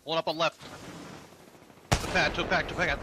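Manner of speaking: loud urgent game callout; shouted callout. Real, not acted. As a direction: call it out fast and loud. Calling out during firefight.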